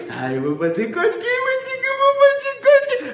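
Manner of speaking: playful tickler voice climbing to a high squeal; hammed-up squeaky tickle threat; mock tickling threat. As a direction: tease as if about to tickle, pitch soaring. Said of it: "Agora faz assim".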